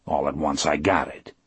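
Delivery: ordinary delivery, nothing marked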